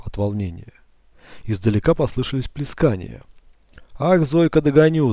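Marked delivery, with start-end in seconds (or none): 4.0-5.1 high, loud playful yell. Acted. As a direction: holler after her in play. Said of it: "завопил Купсик".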